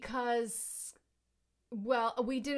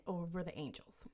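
whispered aside; 4 semitones lower, 8 dB quieter than usual. Real, not acted. Whispered aside about rival team.

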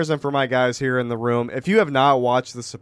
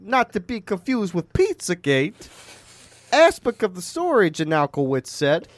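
put-on high-pitched Italian-American gangster accent; Tony Soprano; 7 semitones higher than usual. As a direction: play an indignant Italian-American mobster, pitched way up. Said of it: "Tony Soprano".

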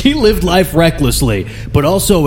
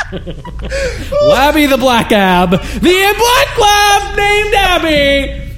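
very high, drawn-out squeal through laughter; slow, high-pitched hysterical outburst; helpless laughing shout; 3 dB louder, much slower than usual. Real, not acted. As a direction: crack up while shouting the tongue-twister. Cracking up over own spoonerism.

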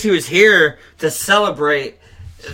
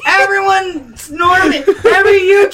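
exasperated cry; 12 semitones higher, 4 dB louder than usual. Real, not acted. Answering his friend's teasing, a high strained shout. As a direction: shout it out in frustration.

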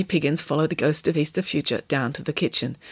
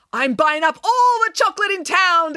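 high, loud triumphant announcement; proud declaration; 12 semitones higher, 5 dB louder than usual. A narrator's reading. Nanny answering Samantha about chocolate, loud proud declaration. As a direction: proclaim it with pride. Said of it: "declared Nanny Piggins proudly".

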